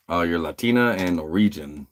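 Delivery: ordinary delivery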